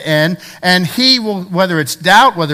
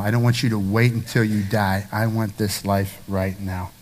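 low, soft and intimate preaching; tender reassurance; 8 semitones lower, 5 dB quieter than usual. Real, not acted. Preacher assuring the congregation of God's love, tender reassurance. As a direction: bring it right down, gently and earnestly.

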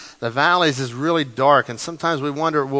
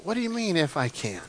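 much softer, a quiet, wondering question; Jesus incredulous, hushed; 9 dB quieter than usual. Acted. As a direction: let the doubt sink in, almost whispered. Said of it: "I would love to have been in that crowd".

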